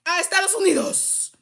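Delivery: sing-song taunt of welcome; mock welcome in Spanish. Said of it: "I said it just like that".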